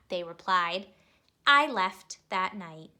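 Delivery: put-on lively storybook narration for kids; expressive read-aloud for young listeners; reading to children. A narrator's reading. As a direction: read it like a bedtime picture book. Reads picture book to children.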